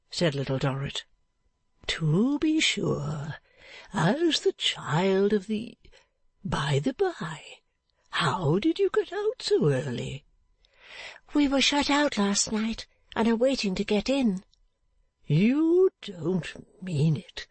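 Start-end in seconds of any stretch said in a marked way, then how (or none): 1.9-10.2 put-on slow, drawn-out old man's voice; good-natured, leisurely old fellow. 11.3-14.4 put-on high, small girlish voice; high-pitched young heroine; timid Little Dorrit.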